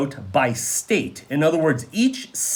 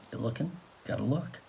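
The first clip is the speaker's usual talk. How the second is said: quiet aside; 5 semitones lower, 15 dB quieter, faster than usual. Real, not acted. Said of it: "teacher coaxes students quietly".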